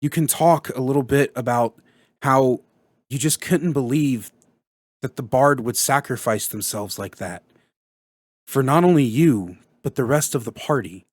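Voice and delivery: low, halting grief with long pauses; demonstrated sorrow, heavy pauses; sad. Shown as a demonstration. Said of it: "think about the last time you were sad".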